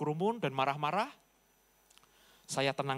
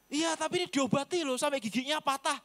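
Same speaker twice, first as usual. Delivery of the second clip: villager voice; 9 semitones higher than usual. Retold or imitated.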